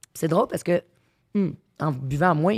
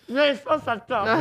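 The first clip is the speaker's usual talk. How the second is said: laughing; 9 semitones higher, slower than usual. Real, not acted. Laughing at friend's craving.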